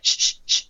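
repeated sharp shush; emphatic shushing. Pretend. Host silences co-host to hear a pretend sound, shushing. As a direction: hush them so they can listen.

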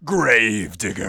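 slow, drawn-out loud announce; monster truck ad. Acted. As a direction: stretch it like a rally host.

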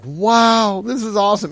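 high, drawn-out "wow" of delight; excited, pitched well up; thrilled. Retold or imitated. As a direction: marvel at a windfall. Retells delight at big check.

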